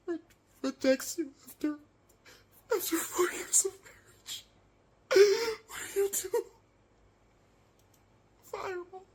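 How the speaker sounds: hammed-up heartbreak; mock sobbing. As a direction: sniffle through it. Mock tearful breakup.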